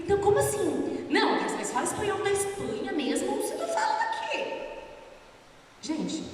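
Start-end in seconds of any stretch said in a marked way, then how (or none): 0.0-4.6 high mock confused voice; retold puzzled question. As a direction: play your younger self baffled, asking higher. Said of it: "Eu fico".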